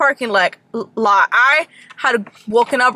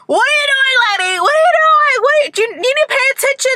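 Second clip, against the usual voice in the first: cop screaming; 13 semitones higher, faster than usual. Retold or imitated. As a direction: scream at the careless driver. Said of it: "cop literally screams".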